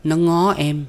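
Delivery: high-pitched pouting complaint; sulky girlfriend. A narrator's reading. Woman telling on his friend, sulky.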